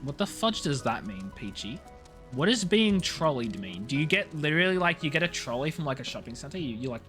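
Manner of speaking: ordinary talk, nothing marked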